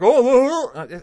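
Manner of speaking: loud, high mock "oh"; exaggerated jokey hooting; mocking attempted funniness. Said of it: "it's just like trying to be funny".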